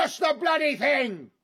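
high, loud furious yell; angry shout. Acted. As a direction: yell in frustration at the door. Angry at stuck door.

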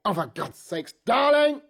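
high, loud exasperated shout; exasperated yell. Acted. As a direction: lose patience and yell her name.

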